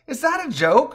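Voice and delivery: very high disbelieving question; high-pitched mock disbelief; incredulous. A narrator's reading. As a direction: scoff in amazement. Incredulous reply in banter.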